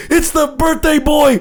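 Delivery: high, excited wrestling reveal; hammed-up high-pitched ring intro; birthday boy announcement. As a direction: proclaim the entrance with mock grandeur. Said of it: "And he's like".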